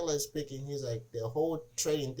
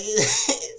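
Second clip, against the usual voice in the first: a sudden high, loud laugh; burst of laughter; 7 semitones higher, 7 dB louder than usual. Real, not acted. Telling the elbow story to his cohost, bursting out laughing. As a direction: crack up at the punchline.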